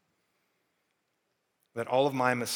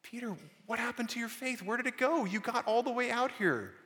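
lightly voiced Jesus, higher and gentle; a mild higher Jesus voice, coaxing Peter; Jesus speaking; 11 semitones higher than usual. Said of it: "Jesus is like".

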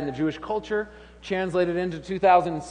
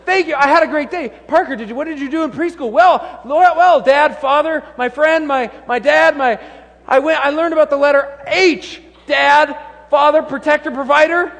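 hammed-up kids' chatter; 10 semitones higher, 10 dB louder than usual. Father and son act out.

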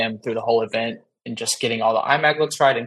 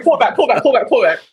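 high, loud urgent repeat; frantic retreat order; 12 semitones higher, 5 dB louder than usual. Retold or imitated. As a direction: rush the crew to back off. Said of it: "I was like".

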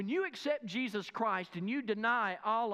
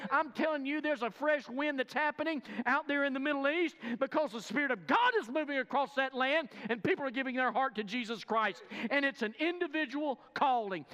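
loud, high fervent preaching; 5 semitones higher, 3 dB louder than usual. Acted. Preacher urging the church, loud and fervent. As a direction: preach it out to the congregation with fire.

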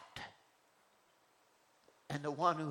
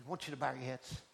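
normal talking voice

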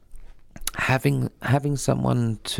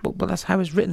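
higher-pitched, imitated defensive protest; mock protest in a raised pitch; retold protest; 8 semitones higher, faster than usual. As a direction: act out your puzzled objection, pitched up. Retold protest at being corrected.

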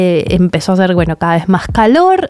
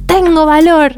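encouraging quote; 9 semitones higher than usual. Retold or imitated. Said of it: "tenés que decir".

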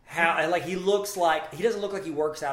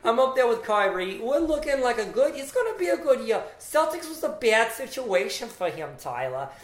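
loud, high, hammed-up New Jersey accent; badly faked Jersey accent, stretched out and pitched up; 6 semitones higher, 5 dB louder than usual. Guest from Jersey chatting about Kyrie, hammed-up Jersey accent. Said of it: "I'm from Jersey".

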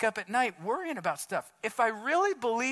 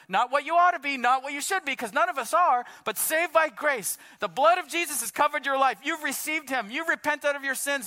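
raised, loud sermon delivery; impassioned preaching; 4 semitones higher, 4 dB louder than usual. Real, not acted. Preacher telling believers they are saved by grace, impassioned preaching. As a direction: preach it hard, higher and forceful.

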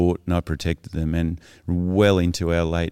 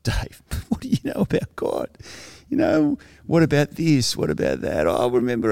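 mocking partygoers; 6 semitones higher than usual. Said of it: "people started to say".

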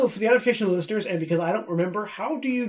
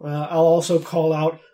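ordinary talk

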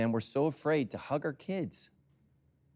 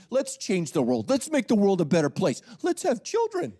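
mock eager couple; 7 semitones higher, 5 dB louder than usual.

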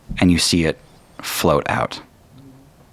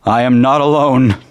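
high, loud desperate cry; 9 semitones higher, 8 dB louder than usual. Acted. Emery crying out alone before the goblins, desperate shout. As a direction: cry it out with all he has. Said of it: "Emery cries out".

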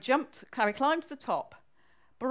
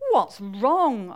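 lightly voiced wife, natural pitch, louder; 8 dB louder than usual. Wife asks sad Harry, lightly voiced and louder. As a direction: ask it firmly in a natural register. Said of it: "asked his wife".

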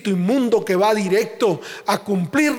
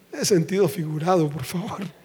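ordinary delivery

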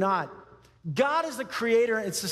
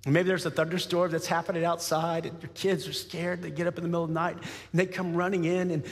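ordinary talk, nothing marked

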